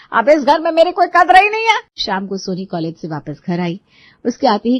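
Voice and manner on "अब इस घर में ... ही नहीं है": loud, high self-pitying wail; wounded complaint. Acted. Mother-in-law complaining to her son, loud hurt outburst. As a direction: cry out that nobody values you.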